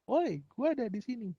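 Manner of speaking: mock shout. Phone out of town yelling for the tower, mock shout. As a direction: holler like a phone with no signal. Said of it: "H P-nya teriak-teriak".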